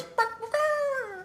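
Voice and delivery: very high, slow yapping bark bark bark; playful imitated barking, soft and squeaky; mock dog barks. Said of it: "she goes".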